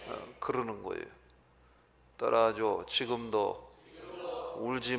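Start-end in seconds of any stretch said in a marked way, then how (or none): none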